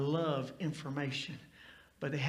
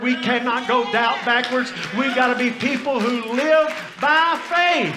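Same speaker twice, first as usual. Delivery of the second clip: louder, higher climactic sermon delivery; full-force preaching; 5 semitones higher, 10 dB louder than usual. Acted. Pastor exhorts his congregation to go faith forward, full-force preaching. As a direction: build it into a rousing altar call.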